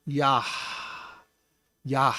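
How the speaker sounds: Yah said as yahh, ending in an audible breath; breathy final h. Shown as a demonstration. Teacher sounding Yod and Hey together, Yah with a breathed h. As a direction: let Yah trail into a long breath. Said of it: "So there's a breath".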